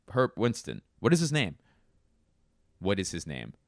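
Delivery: usual speaking voice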